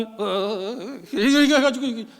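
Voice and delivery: a hint of a fumbling shaman, hemming; mock shaman. Preacher mocks a TV shaman guessing World Cup goals, hesitant. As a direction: mimic a shaman stalling over the cards. Mocking a TV shaman's fortune-telling.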